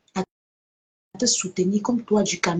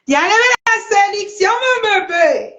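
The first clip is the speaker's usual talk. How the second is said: mocking sugary plea; 11 semitones higher, 4 dB louder than usual.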